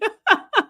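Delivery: real high burst of laughter. Real, not acted. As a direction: laugh out loud at the joke. Laughing at chat joke.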